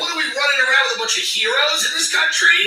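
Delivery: mocking high-pitched outrage; sarcastic rising shout. Real, not acted. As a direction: scoff at the very idea. Sarcastic rant at listener.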